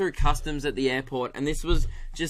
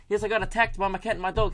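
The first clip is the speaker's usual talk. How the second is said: fast, higher mock Kiwi caller; New Zealand accent; 5 semitones higher, faster than usual. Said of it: "I've got it now".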